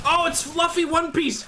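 high, loud, excited blurt; panicked outburst. Real, not acted. Spotting a character in panic, high and loud. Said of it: "I was panicking".

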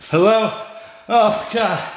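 high, loud call then fright. Real, not acted. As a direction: call out and startle.

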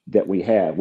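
usual speaking voice

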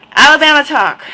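loud, high Alabama twang; big, loud mock-Alabama accent; Southern accent. Shown as a demonstration. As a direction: belt it out in an exaggerated Southern accent. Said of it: "It is a Southern accent".

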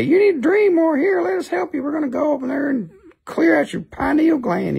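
very high squeaky worm voice; dream worms' voice. Acted. Little worms offering to help a dreamer, high squeaky voice. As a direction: pipe up as eager little helper worms. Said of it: "they'll say".

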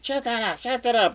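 high-pitched mock complaint; mimicked girl's high voice; girlfriend imitation. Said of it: "And she's like".

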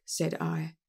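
plain reading voice

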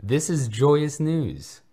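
put-on high, loud and slow overjoyed boy; delighted. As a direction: savour every word with glee. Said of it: "Harry exclaimed delightedly".

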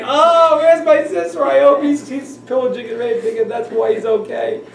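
high, loud, hammed-up lament; loud, high imitated mother's cry; mock crying mother. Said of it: "her cry is like".

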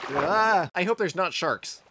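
ordinary talk, nothing marked

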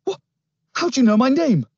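put-on high, loud startled boy; stunned question; shocked boy. A narrator's reading.

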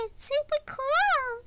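normal talking voice

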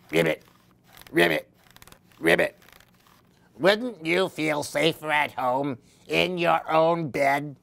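lightly croaked frog voice; a hint of a frog's croak. Frog teasing Baby Chick with a light croak. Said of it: "he croaked".

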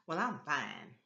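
ordinary delivery

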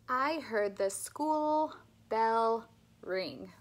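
"love life" with slow, clear L; slow, careful speech-therapy model; slow clear L drill. Shown as a demonstration.

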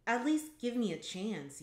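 urgent narrated pleading. A narrator's reading. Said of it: "you plead".

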